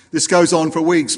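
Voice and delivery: ordinary delivery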